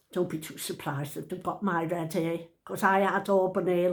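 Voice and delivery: usual speaking voice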